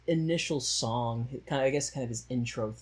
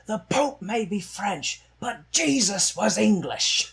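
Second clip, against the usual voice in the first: smug Englishman; 8 semitones higher, 5 dB louder, slower than usual. Retold or imitated. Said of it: "one of the English guys goes".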